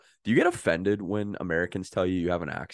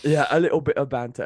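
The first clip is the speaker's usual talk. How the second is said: raised, louder mock British banter; playful British-style put-on; played-up British accent; 6 semitones higher, 6 dB louder, faster than usual. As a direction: echo it in a hammed-up British accent.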